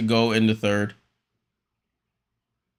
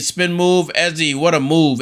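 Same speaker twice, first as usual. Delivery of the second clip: louder, higher burst at the big play; excited call; 5 semitones higher, 4 dB louder than usual. Real, not acted. Streamer thrilled by a spin move, excited call. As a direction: jump on the highlight.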